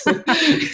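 short high laugh; high burst of laughter. Real, not acted. Laughs at story.

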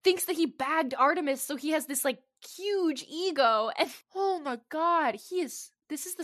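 high, loud, worked-up rant; excited. Real, not acted. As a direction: gush in horrified disbelief. Excited disgusted rant about villain.

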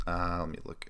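whispered aside while checking notes; soft whispered lookup, half to himself. Real, not acted. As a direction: drop to a whisper while reading off the stats. Checking stats mid-podcast.

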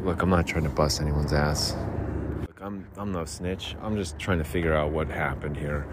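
understated cop questioning; mock cop murmur. Cop investigating beer.

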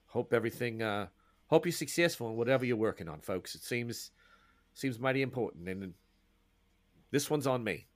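easygoing Australian boatman offering condolences; Australian accent. Acted. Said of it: "whose Australian accent".

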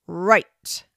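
loud, commanding quizmaster tree; booming tree teacher. A narrator's reading. Oak tree starts the spelling test, booming. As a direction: boom it out as the oak. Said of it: "said a deep, loud voice".

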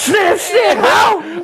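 high shouted catchphrase, full voice; screamed ad lib. Retold or imitated. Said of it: "I'm screaming that shit".

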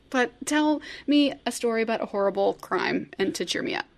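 ordinary talk, nothing marked

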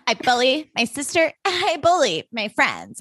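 lampoon a corny confession; mock earnest speech. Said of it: "Her being like".

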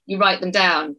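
usual speaking voice

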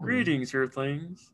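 high, light Kermit greeting; a hint of Kermit the Frog. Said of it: "in a Kermit the Frog voice".

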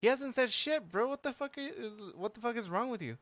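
high, exasperated disbelief; freaked-out disbelief; incredulous high. Retold or imitated. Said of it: "I was like".

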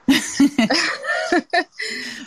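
short amused laugh; light rising chuckle; real laugh. Real, not acted. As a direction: laugh it off, a bit embarrassed. Laughs at own accent story.

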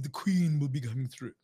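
lightly voiced butler announcement; a hint of formal palace staff. Butler telling the hairdresser to expect the Queen, lightly voiced butler. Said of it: "the butler came up to him and went".

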